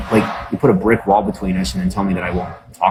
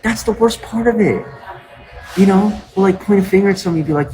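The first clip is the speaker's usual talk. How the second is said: much higher, fast worked-up ranting; 12 semitones higher than usual. Real, not acted. Streamer rants to chat about blaming others, high and fast. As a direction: push it up and rush it, fired up.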